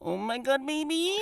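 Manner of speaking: high, hammed-up porn-film moan; mock breathy porn line, pitched high; cheesy porn voice. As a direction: overdo it like a cheap porn film. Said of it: "like some low budget porn film".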